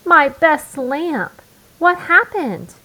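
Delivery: high and loud, the first words drawn out slowly; dismayed. Acted. Mama demanding answers over the broken lamp, loud dismay. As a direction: she can't believe her best lamp is broken. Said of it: "she asked".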